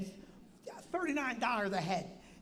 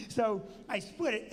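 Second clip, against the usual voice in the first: ordinary talk, nothing marked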